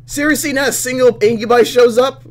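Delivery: high, loud incredulous outburst; loud disbelief. Real, not acted. Player complaining to the game that nobody showed up, incredulous.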